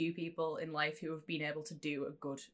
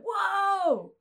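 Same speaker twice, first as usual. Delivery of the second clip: much higher, loud crowd gasp of awe; amazed crowd; 6 dB louder than usual. Retold or imitated. Dickens audience reacting to the reading, a loud gasp of awe. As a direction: gasp like a thrilled concert crowd. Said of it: "everyone in the crowd's like".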